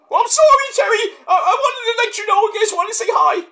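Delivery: very high, whimpering apology with a laugh; squeaky sulking Cactus voice; Cactus apologizing. Acted. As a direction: sound hurt and childlike. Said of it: "Mick Foley impersonation".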